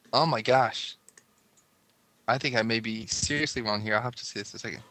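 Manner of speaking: ordinary talk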